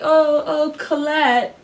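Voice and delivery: a mock crooning of the name; a hint of the movie character calling Colette; imitating his character. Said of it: "where he's like".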